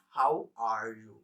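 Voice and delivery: "How are you?" drawn out slowly, each word separate; slow demo phrase. Shown as a demonstration. Teacher slowly presenting the phrase to students. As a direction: spell it out slowly for learners. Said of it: "This is a complete sentence".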